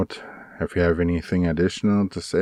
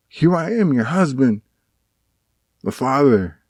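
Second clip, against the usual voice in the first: much higher and louder, begging to be heard; reenacted pleading; 9 semitones higher, 5 dB louder than usual. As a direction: replay the desperate plea to his wife. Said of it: "I'm just making all these pleas".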